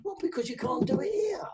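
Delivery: a sharp whisper after the mock student; hushed punchline aside. Real, not acted. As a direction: drop to a knowing whisper.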